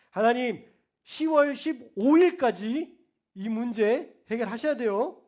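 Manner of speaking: loud, slow, like a debt collector; demanding prayer. Shown as a demonstration. Pastor mocking a prayer that commands God, loud and slow. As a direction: order God to pay up, loudly. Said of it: "채무자처럼, 돈 받으러 가는 자처럼".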